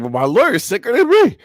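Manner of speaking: high, loud mock protest; imagined client protest. Mocking the man bragging about his lawyer, high protest. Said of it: "he'd be like".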